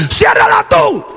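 high yelled talk-back; mock shout back at dad; a son yelling back at his father, very high; defiant yell. Speaker defies father.

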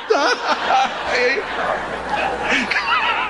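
high, shouted burst of laughter; real hearty laugh. Real, not acted. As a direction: let the laughter break out. Laughs at own story.

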